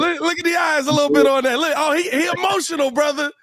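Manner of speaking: very high, excited teasing voice; high-pitched teasing. Real, not acted. Host teasing coach about his emotional dog, high-pitched teasing. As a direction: squeal it high, ribbing him about the dog.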